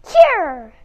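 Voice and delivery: sudden loud shout; donkey shouting as he kicks. Acted. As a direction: bark it as the kick lands. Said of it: "shouted the donkey".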